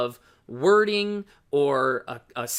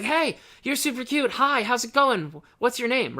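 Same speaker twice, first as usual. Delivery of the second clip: much higher, overexcited gush; manic greeting; 9 semitones higher, 3 dB louder than usual. Shown as a demonstration. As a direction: sound frantic after the sprint. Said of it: "sprinting up just to be like".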